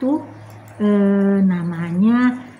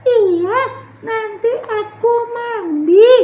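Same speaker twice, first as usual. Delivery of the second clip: put-on high child voice, a bit louder; little Tiur; 11 semitones higher, 4 dB louder, slower than usual. Tiur promising her sister to bathe later, in a child voice. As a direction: promise it like a small kid.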